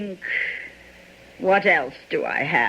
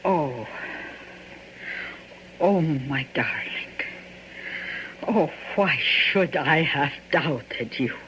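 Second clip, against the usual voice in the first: tender relief; 2 semitones lower, 4 dB quieter than usual. Acted. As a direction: melt into relief, softly. Wife reassured, loving reply.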